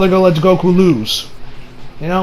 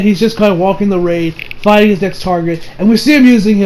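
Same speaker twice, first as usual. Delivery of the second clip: ordinary talk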